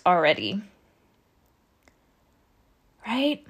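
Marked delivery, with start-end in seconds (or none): 3.1-3.4 long pause, then a quiet rising Right?; dramatic pause. Shown as a demonstration. Coach to her audience, mock dramatic pause before Right?. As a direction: let the silence hang before Right?. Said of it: "Dramatic pause".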